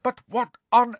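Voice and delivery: high, exasperated tirade; outraged teacher at full steam; angry berating. Acted. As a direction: pour out indignant questions. Enraged professor berates the pupils.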